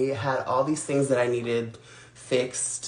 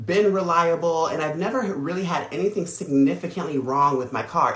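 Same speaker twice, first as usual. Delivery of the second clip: normal talking voice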